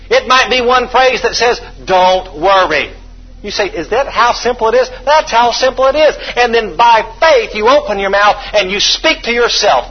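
high, loud preaching push; rising sermon fervor; full-force preaching. Acted. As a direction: preach it hard to the room.

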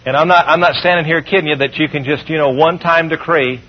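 ordinary delivery

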